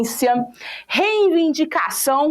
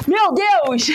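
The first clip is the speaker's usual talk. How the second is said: meu Deus! raised high and a bit louder in mock alarm; mock panicked gasp; 4 semitones higher, 3 dB louder than usual. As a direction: cry out meu Deus like you just got knocked over by a wave. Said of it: "aí tu fica assim". (exclamação de susto fingido, mais aguda)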